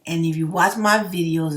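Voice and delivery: ordinary talk, nothing marked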